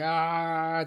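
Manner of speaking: sustained loud yell; charging scream. Acted. Player as Krill still running in, drawn-out scream. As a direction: keep the battle cry going mid-charge. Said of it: "just charges, screaming".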